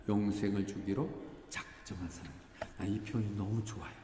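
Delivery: ordinary delivery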